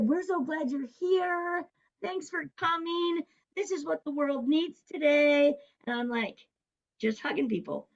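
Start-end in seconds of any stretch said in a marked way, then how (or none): 0.0-5.5 gushing welcome. Retold or imitated. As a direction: imitate a delighted crowd greeting, pitched way up. Retells crowd's grateful welcome.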